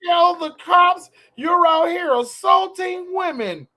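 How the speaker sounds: high, loud, drawn-out threat; mock outraged woman. Host mimicking woman threatening police, mock outraged voice.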